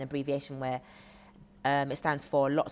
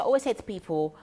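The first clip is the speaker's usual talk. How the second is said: ordinary delivery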